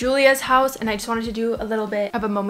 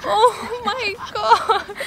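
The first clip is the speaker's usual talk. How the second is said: high wail breaking into laughter; laughing in dismay; 11 semitones higher, much slower than usual. Real, not acted. Owner upset about abandoned kittens, laughing in dismay. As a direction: laugh helplessly through a high, dismayed cry. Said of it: "I shouldn't be laughing".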